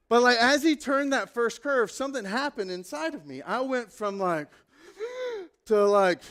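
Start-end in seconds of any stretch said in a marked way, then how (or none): 5.0-5.4 high, startled intake of breath; sharp gasp. Retold or imitated. Passenger taking the first curve again, sharp scared gasp. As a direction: suck in air in fright. Fear before the shift.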